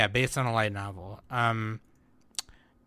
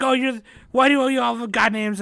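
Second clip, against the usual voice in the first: high, loud quoted question; mock character voice; 10 semitones higher, 6 dB louder than usual. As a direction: squeak out the confused question. Retelling a character's confused question.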